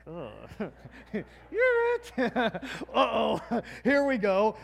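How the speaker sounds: mock-groaning "Ugh, you're it." with a high squeak and laughs; negative tone. Shown as a demonstration. Pastor showing the wrong way to tell the neighbor, mock-grumpy with laughter. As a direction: ham up a reluctant, laughing "you're it". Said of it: "Don't do it with such negative tone".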